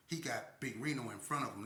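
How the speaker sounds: normal talking voice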